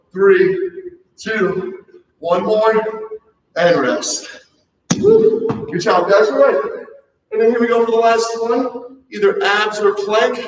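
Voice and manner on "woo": high bright whoop; excited whoop. Real, not acted. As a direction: celebrate the finished set. Cheer at end of set.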